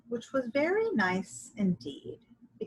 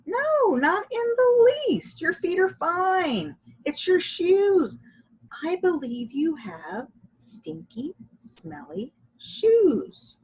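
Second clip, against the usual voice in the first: high, bright little-lady voice, quick then louder; Dolores voice; 9 semitones higher, 5 dB louder, slower than usual. A narrator's reading. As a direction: play Dolores delighted, higher voice.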